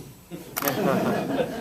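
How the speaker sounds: brief amused chuckle; short chuckle; laugh. Real, not acted. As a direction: chuckle fondly. Laughs about madcap director.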